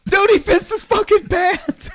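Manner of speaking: hammed-up amazed friends; incredulous mock voice. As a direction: stretch it out in disbelief. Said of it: "looking at each other like".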